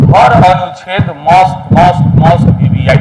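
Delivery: "must" repeated slowly, each one drawn out with weight; emphatic insistence. Real, not acted. Teacher insisting every article is vital for the exam, slow emphatic stress.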